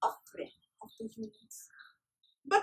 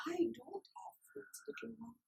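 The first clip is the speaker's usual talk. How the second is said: softer, tender fading end; gentle soft reply; 8 dB quieter than usual. A narrator's reading. Weak snake refusing the fruits, soft and tender. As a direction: speak kindly to the child. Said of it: "And Rudra said".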